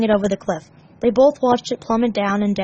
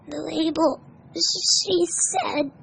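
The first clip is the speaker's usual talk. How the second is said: sniffling; 7 semitones higher, 3 dB quieter, much slower than usual. Acted. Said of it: "Tails sniffed".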